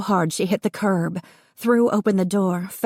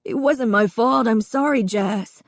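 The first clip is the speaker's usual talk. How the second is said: sobbing; 6 semitones higher than usual. A narrator's reading. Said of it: "he said, sobbing".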